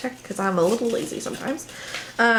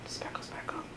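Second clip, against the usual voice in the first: soft unvoiced whisper; whispered sparkle; 18 dB quieter than usual. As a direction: breathe it like a secret.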